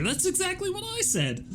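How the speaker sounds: very high, squeaky little-guy voice; squeaky character voice. Pretend. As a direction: pipe it up high like a tiny cartoon bug. Voicing Beep's excited reply.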